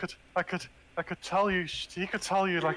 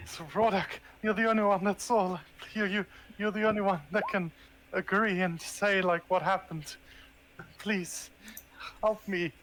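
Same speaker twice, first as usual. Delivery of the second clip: higher-pitched, desperate appeal; desperate pleading; 4 semitones higher, slower than usual. Acted. Character begs Rodak to confirm what happened, desperate. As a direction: plead for backup, with a touch of desperation. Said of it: "I'm just desperately looking around".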